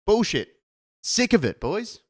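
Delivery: loud, high fed-up cry; loud high exasperated outburst. Real, not acted. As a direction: vent your frustration. Fed-up outburst at end of rant.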